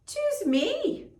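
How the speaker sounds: squeaky high begging cat; pleading cat voice. Acted. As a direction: plead like an eager little cat. Gray cat begs old man.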